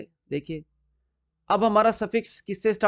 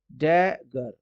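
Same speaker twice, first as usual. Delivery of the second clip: Dagger slowly split as डै, then गर; two separate syllables; 4 semitones lower, much slower than usual. Shown as a demonstration. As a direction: break dagger in two, leaning on डै. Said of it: "दो syllable".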